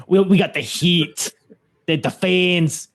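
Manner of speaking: weak New Jersey wrestler voice; New Jersey accent. Retold or imitated. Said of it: "I can't do a New Jersey accent".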